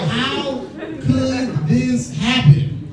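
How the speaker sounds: slow, drawn-out mock despair through a laugh; mock panic. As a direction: stretch it out like the world is ending. Panicked reaction to family photo.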